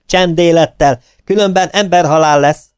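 high, loud menacing threat upward; threatening shout. A narrator's reading. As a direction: threaten the noisy stagehand above. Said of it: "Fenyegetően".

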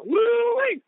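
high, loud joyful woo-wee; excited whoop. Real, not acted. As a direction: whoop it out with delight. Cheering quote on excitement.

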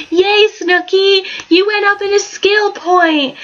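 high drawn-out cheer; slow excited yay. Real, not acted. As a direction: cheer her on like a proud parent. Cheering the Sim.

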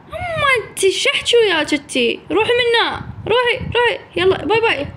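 high, playful get-lost taunt; high teasing dismissal; mock shooing. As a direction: shoo her off teasingly. Shooing the copycat away.

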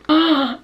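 high sharp gasp; surprised gasp. Real, not acted. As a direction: gasp with delight. Reacts to a chat partner.

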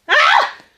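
high loud outraged cry; startled angry yelp; sudden cry. Acted. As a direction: burst out with a cry. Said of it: "lanciò un grido".

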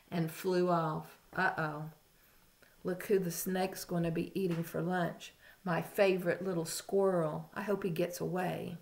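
ordinary delivery, nothing marked

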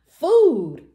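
loud, high repeated yell; big storybook shout, falling away; thundering shout. A narrator's reading. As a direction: thunder it out greedily. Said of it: "thundered Tiny".

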